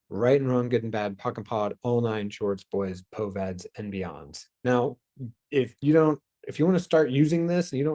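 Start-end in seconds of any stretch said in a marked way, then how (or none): 0.1-4.4 quiet, rapid ritual recital of the clearing statement. Acted. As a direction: rattle off the formula softly, like an incantation.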